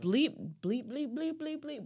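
mock censor beeps; bleeped out. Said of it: "why would you say it so I have to bleep it out?".

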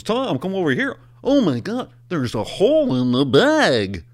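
high-pitched, hammed-up astonishment; mock alarm. Imitating a customs officer alerting a colleague at security, mock alarm.

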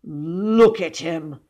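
slow, drawn-out indignant exclamation; heavy, aggrieved emphasis; exasperated father. A narrator's reading. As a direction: present the culprit in outraged disbelief. Exasperated father points at William.

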